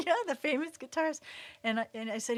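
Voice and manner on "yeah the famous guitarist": higher, quick and laughing; laughing confirmation. Retold or imitated. Daughter laughing as she confirms to mom, higher. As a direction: giggle it out. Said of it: "And I go".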